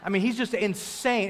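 ordinary talk, nothing marked

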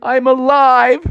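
high, triumphant answer; elated. Acted. Bear answers his crying mom, high elated cry. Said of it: "And he's like".

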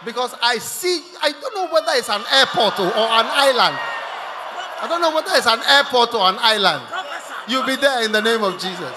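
booming prophetic shout; full-force preaching. Real, not acted. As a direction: shout it out over the congregation.

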